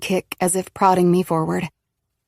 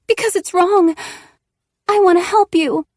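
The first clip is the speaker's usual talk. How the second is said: much higher, louder voice of Eve pleading; Eve's voice; 12 semitones higher, 5 dB louder than usual. Acted. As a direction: plead as Eve, the friend trying to help. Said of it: "Nola says in Eve's voice".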